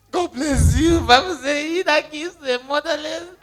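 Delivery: loud, high, pleading blessing; hammed-up grateful beggar; effusive mock thanks. Mimics her old effusive reply.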